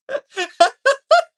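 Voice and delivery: cackling at their own joke; high, loud burst of laughter. Real, not acted. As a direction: crack up helplessly. Cracking up at own bit.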